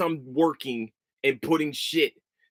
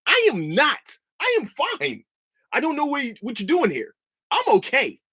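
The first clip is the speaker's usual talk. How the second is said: mock denial; 11 semitones higher than usual. Mocking the coworker who denies his tantrum, high-pitched. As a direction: mimic him, high and defensive.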